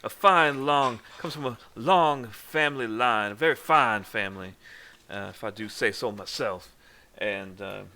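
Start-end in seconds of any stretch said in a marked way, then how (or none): 0.0-6.8 hammed-up genteel Southern pride; proud Southern gentleman. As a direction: brag grandly about fine family lineage. Game master voices proud Southern introducer.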